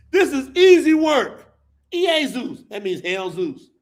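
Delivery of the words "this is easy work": louder, higher proclamation; loud declaration. Pretend. Comic repeating the line to the crowd, loud declaration. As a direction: announce it boldly.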